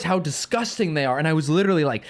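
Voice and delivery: usual speaking voice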